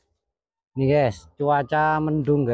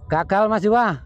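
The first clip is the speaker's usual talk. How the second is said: raised voice, high and carrying; calling out; 8 semitones higher, 3 dB louder than usual. Real, not acted. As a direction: call across the field. Calling out across field.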